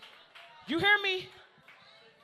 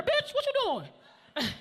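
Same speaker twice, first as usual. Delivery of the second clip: high, slow drawn-out mock threat; mock tough threat; 12 semitones higher, slower than usual. Comic warning to someone walking up, hammed-up high drawl. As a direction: warn off a heckler, hammed up. Acted threat to audience member.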